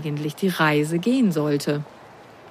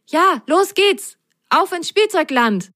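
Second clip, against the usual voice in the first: excited; 10 semitones higher than usual. A narrator's reading. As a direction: cheer them off on an adventure. Eager start of the adventure.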